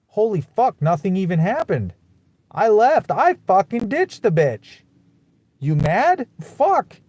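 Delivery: real anger boiling over at the ex; furious outburst. Real, not acted. Ranting to the camera about his ex, furious and loud. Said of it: "I'm just still pissed".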